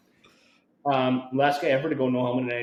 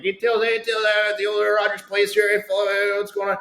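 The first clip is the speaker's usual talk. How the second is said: higher, louder hail down the line; calling out on the phone; 6 semitones higher, 4 dB louder than usual. Retold or imitated. Calling his buddy Taylor on the phone, loud and high. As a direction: call him up like an old buddy.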